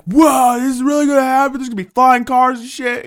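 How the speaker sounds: loud, high-pitched wowed voice; mock naive amazement. Naive public awaiting the future, mock amazed shouting. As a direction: imitate a gullible crowd, awestruck. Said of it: "People were so naive back then".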